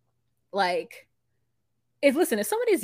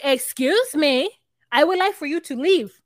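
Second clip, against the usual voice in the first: mock indignant demand; 5 semitones higher, 5 dB louder than usual. Imitating Jamie telling her to leave, mock indignant.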